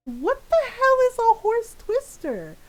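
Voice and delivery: acted high-pitched disbelief; baffled question. Retold baffled question to mom.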